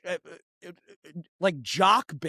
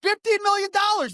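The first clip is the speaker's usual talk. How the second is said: very high, loud indignant cry; outraged; 14 semitones higher, 6 dB louder than usual. Real, not acted. Ranting about the ad budget, outraged high cry. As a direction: exclaim it in disbelief. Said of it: "really pissed me off".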